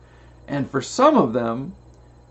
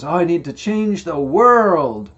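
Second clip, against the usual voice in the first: higher, louder mock self-important voice; mock grandiose; 5 semitones higher, 6 dB louder than usual. Speaker mocks his own grandiose dreams, higher louder pompous voice. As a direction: puff up like a would-be world changer. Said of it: "sometimes it's so grandiose".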